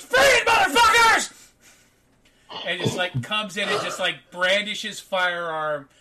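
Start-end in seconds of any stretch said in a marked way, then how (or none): 0.0-1.3 high-pitched, full-throated shout; armed guard's loud, high command; security guard shouting. Acted. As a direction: burst in screaming orders at the room. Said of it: "he goes".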